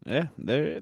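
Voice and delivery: ordinary talk, nothing marked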